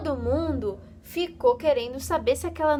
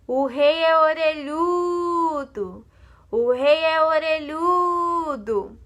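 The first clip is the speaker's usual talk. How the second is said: slow, drawn-out shout, higher and louder; shouting into a hole; 6 semitones higher, 5 dB louder, slower than usual. Acted. The barber yelling the secret into a hole, slow loud shout. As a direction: yell the secret down into the hole, stretching each word. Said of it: "gritou dentro do buraco".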